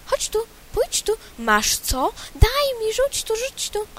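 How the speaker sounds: high, eager birdlike pleading; quail calls. Acted. Quail calling the others over, high and eager. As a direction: beg like a greedy little quail. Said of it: "Przepióreczka".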